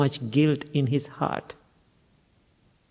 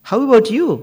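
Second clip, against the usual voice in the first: higher, louder pointed question; pointed challenge; 8 semitones higher, 5 dB louder, faster than usual. Acted. Son challenges the grieving father, higher and louder. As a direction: needle him with the question. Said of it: "young divine being said".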